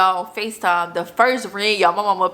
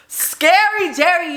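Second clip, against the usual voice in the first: mocking taunt; 9 semitones higher, 3 dB louder, slower than usual. Mocking the scared friend.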